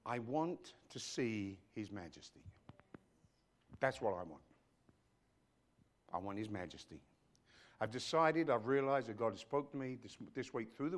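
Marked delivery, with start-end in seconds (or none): 0.1-7.0 solemn longing. Real, not acted. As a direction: confess a deep longing, softly. Solemn confession of spiritual longing.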